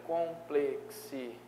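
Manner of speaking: complexidade split slowly into syllables; syllabified. Shown as a demonstration. Teacher checking the split for the class: complexidade, slow syllables.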